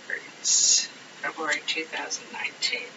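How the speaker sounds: very soft, half-whispered to yourself; barely audible incantation over the cards; hushed murmur. Real, not acted. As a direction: murmur it under your breath. Murmuring the question while shuffling cards.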